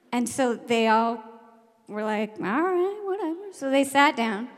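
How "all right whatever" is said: high-pitched reluctant grumble; grudging kids. Retold or imitated. Kids told to sit down, grudging compliance. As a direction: give in like sulky schoolkids.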